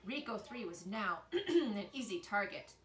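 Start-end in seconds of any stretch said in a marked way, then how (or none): none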